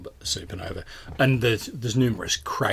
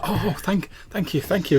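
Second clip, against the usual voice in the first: high-pitched grateful gush; gushing thanks; 9 semitones higher, 4 dB louder than usual. Acted. Young fans thank club owner, gushing and high. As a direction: thank repeatedly with an excited lift.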